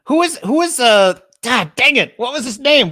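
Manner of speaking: high, loud exasperated straining for a name; frustrated groping. Real, not acted. Host forgetting a character's name, high exasperated groan. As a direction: blurt it out, annoyed you can't remember.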